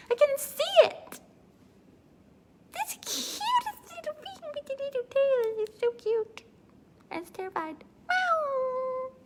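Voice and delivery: squeaky high cutesy creature voice; cute finger monkey. Retold or imitated. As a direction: coo like a tiny excitable pet. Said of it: "imagine the cutest little excitable tiny little thing".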